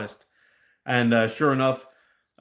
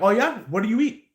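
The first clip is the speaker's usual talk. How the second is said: skeptical challenge; 8 semitones higher than usual. Retold or imitated. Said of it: "I'm like".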